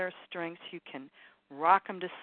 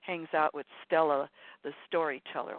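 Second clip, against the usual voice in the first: ordinary talk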